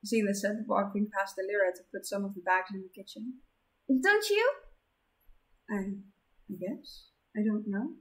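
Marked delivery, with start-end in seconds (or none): 3.9-4.5 high bright teasing question; chirpy nudge; excited. Acted. 5.7-7.1 softer, slow hesitant stammer; timid unsure mumble; shy stammer. Acted. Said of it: "Delira stammered".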